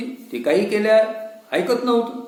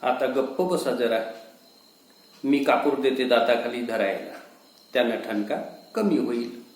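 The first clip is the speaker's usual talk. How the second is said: soothing wife; 7 semitones lower, 4 dB quieter than usual. Acted. Wife soothing, speaking low.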